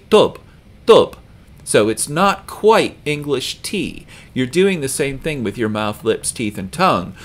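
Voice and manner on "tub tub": tub without any puff after the T; high, punched "tub" with unaspirated T. Shown as a demonstration. Teacher correcting to the Arabic way, unaspirated tub. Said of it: "we don't do that in Arabic".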